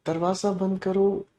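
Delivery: quiet, gentle request; soft request. Shown as a demonstration. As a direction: ask softly, not commanding. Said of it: "आपकी tone बदल गई". (धीमी आवाज़ में विनती)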